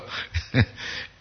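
soft breathy chuckle; wheezy little laugh; laughter. Real, not acted. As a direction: laugh quietly under the breath. Amused while moving on.

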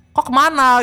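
high, loud mock question; jokey dialect chat with a friend, hammed up; laughing banter. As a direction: call it out high, on the edge of laughter. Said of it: "Karena ketawa-ketawa ngomongnya".

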